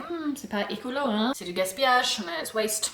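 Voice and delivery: lightly voiced French disapproval, rising hmm; a tutting French self in French; voix française réprobatrice, à peine jouée; French inner voice. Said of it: "French side of me as well that's like".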